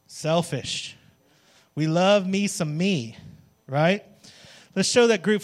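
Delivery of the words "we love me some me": usual speaking voice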